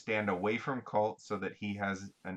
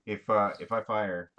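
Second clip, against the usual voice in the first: louder repeat to be heard; speaking up; 5 dB louder than usual. Real, not acted. Player repeats the plan for one who didn't hear, speaking up. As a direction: raise your voice for someone who missed it. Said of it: "I didn't hear it".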